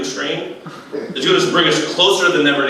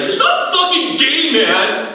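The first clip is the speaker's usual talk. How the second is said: high, slow indignant protest; hammed-up offended jock; mock outraged wrestler; 11 semitones higher, slower than usual. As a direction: defend the sport in a squeaky huff. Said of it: "it's like".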